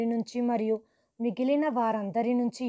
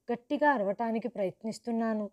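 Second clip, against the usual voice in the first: usual speaking voice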